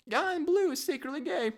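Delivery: very high squawking parrot voice; squawky parrot. Acted. Parrot outing a visitor, high squawky voice. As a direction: screech it like a parrot.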